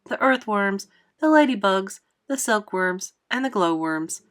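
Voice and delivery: usual speaking voice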